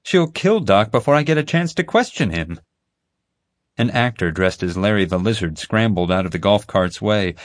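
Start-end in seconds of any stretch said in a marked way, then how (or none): 0.0-2.6 high, shocked blurt at her sister's driving; alarmed disbelief. A narrator's reading. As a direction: pitch it up in alarm. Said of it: "Molly gasped".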